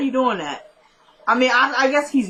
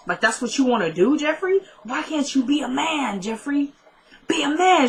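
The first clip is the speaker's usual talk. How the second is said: high-pitched, exasperated retold scolding; berating mother; 5 semitones higher than usual. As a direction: imitate a mother shaming her son, pitch climbing. Retold mother berating her son.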